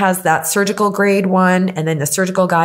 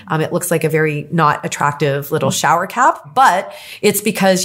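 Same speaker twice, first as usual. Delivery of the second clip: usual speaking voice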